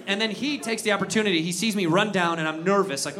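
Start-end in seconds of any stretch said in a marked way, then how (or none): none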